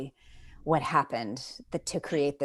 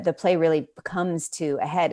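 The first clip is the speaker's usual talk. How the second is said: usual speaking voice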